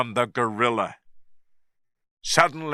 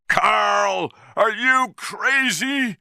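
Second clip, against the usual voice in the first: shouted; 9 semitones higher, slower than usual. A narrator's reading. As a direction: yell at him in disbelief. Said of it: "Jack shouted".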